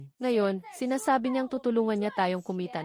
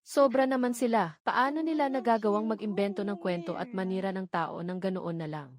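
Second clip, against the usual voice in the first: ordinary talk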